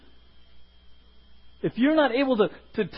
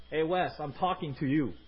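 voice of God; 5 semitones lower, 8 dB quieter than usual. Retold or imitated. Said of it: "and say".

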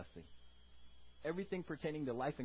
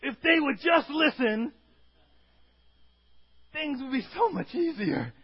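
very high, very loud outburst; hammed-up shout of parental frustration; more exasperated parent; 11 semitones higher, 12 dB louder than usual. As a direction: act a parent completely fed up, shouting it. Repeats exasperated parent line, louder.

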